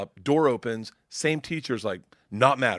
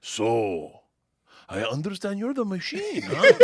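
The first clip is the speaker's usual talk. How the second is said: put-on drawn-out, rising accented question; Russian cop; 6 semitones higher, slower than usual. Cop to student pulled close, suspenseful Russian-accented question. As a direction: imitate a Russian cop sizing someone up. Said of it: "and he goes".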